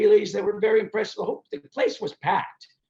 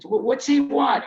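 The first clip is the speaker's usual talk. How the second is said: mock impatient dad; 9 semitones higher, 5 dB louder than usual. Dad acted, reacting to visitor.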